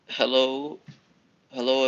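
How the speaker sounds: ordinary talk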